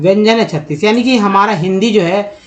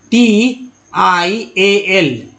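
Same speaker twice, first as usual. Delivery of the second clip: slow letter spelling; much slower than usual. Shown as a demonstration. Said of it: "ये स से उच्चारण यहाँ हो रहा है".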